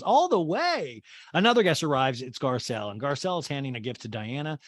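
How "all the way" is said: high, drawn-out mock urging; Kyle impression. Kyle eggs on the guest, high and drawn-out. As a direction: pitch it up and stretch every word. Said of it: "And Kyle goes".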